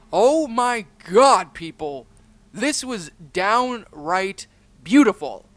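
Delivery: loud, high-pitched, emphatic host voice; host's outro. Acted. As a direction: hype up the story to your audience.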